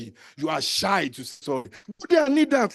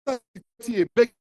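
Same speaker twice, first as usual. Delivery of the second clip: ordinary delivery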